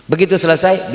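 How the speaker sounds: ordinary delivery, nothing marked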